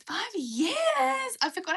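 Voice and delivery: loud, high burst of astonishment; amazed exclamation. Real, not acted. Streamer reacting to a viewer following for five years, amazed. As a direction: exclaim it in real disbelief.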